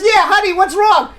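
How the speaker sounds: clueless husband. Acted. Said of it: "And he says".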